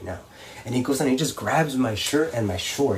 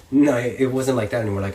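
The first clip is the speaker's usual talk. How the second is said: ordinary talk, nothing marked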